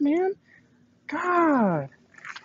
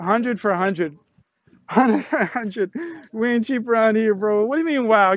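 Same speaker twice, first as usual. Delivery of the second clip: ordinary delivery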